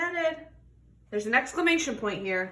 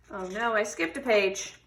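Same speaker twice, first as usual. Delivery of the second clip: ordinary delivery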